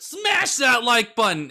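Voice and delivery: loud, slow YouTuber call; mock hype outro. Giving the viewers the outro instructions, mock loud. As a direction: shout the outro line. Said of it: "asked for the outro instructions".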